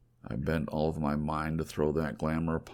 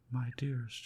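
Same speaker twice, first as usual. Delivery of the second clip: a lightly voiced young woman, higher and soft; a loving woman's plea, gently raised in pitch; tender plea; 9 semitones higher, 5 dB quieter than usual. Beloved woman pleading with him, softly raised pitch.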